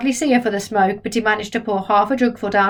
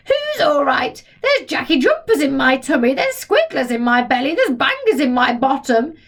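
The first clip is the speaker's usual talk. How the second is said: outraged grandma; 5 semitones higher than usual. Acted. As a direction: complain in mild outrage. Grandma voiced.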